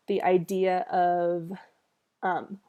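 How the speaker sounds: ordinary talk, nothing marked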